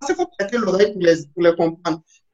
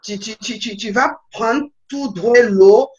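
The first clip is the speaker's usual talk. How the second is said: slow, drawn-out mock Quebec accent; imitation québécoise; 2 semitones higher, 4 dB louder, slower than usual. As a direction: imitate a Quebecer giving directions, stretching the vowels. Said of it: "Il me dit". (accent québécois imité, lent et étiré)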